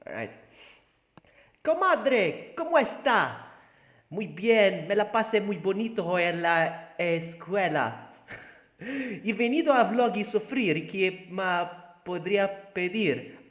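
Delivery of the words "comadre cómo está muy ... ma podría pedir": loud, high-pitched attempt at a Spanish accent. Shown as a demonstration. Streamer showing viewers his Spanish, in a loud put-on Spanish accent. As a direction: show off a hammed-up Spanish accent. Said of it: "Tell me if I have the accent".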